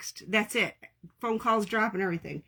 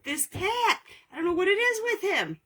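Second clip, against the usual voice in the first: high exasperated squeal; much higher, fond exasperation; excited squeal; 10 semitones higher than usual. Real, not acted. Exasperated at cat.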